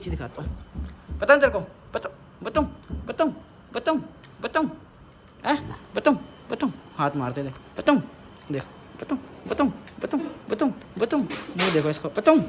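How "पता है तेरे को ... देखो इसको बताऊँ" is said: high, punchy teasing of a pet; playful mock threat. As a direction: repeat it in short, high mock-warning bursts.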